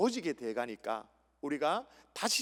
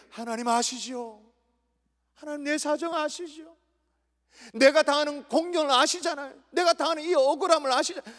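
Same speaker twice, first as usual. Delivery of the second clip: pleading prayer; 7 semitones higher than usual. Acted. Preacher shows the wronged how to pray, high pleading. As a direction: pour out a grievance before God. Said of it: "어떻게 기도해요?".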